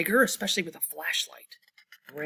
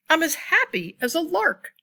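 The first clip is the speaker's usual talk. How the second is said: higher, bright and louder; happy; 8 semitones higher, 5 dB louder than usual. Acted. Reading to children, a happy high voice. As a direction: sing it out with delight.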